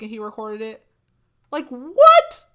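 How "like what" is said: very high, loud disbelieving squeal; real amazement bursting out; stunned. Real, not acted. Host reacting to Cassian's gift, a loud stunned squeal.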